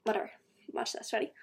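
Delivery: ordinary talk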